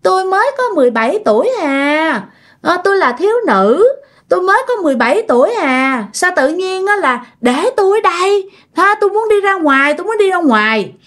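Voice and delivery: put-on a grandmother's body speaking as a restless seventeen-year-old; possessed grandma as a young girl. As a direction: play the old woman now talking like a teen girl. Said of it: "Nói lải nhải".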